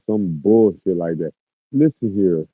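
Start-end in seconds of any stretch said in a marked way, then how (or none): 1.7-2.5 loud, firm call for attention; stern command. Real, not acted. Streamer telling off a poster, loud and firm. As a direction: demand they listen up. Scolding chat, repeated command.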